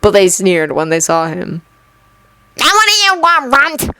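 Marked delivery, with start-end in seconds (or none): 2.6-4.0 high mocking sneer of a bully; a bully jeering at a smaller boy, sneering. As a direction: look down your nose at the runt.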